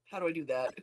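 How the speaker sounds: normal talking voice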